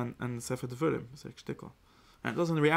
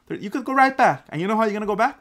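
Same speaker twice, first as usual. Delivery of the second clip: acted taunt; 8 semitones higher, 8 dB louder than usual. Acting out God's taunting threat.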